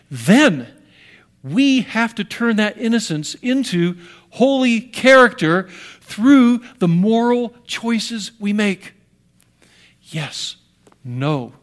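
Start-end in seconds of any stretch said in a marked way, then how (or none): 1.4-8.9 slow, loud and higher, each word driven home; preacher pressing the point hard; preaching emphasis. Real, not acted. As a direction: hammer it slowly to the crowd. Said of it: "preaching emphasis".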